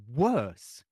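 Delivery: a loud incredulous echo of the word. A narrator's reading. Mother disbelieving the priest's warning, loud and incredulous. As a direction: throw the word back in disbelief.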